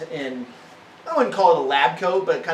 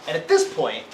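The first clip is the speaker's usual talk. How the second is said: normal talking voice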